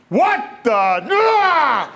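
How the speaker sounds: high, loud, exasperated yell; high, loud mock outburst from behind the trucks; startled shout. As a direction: blurt it out in disbelief. Sergeant reacts from hiding.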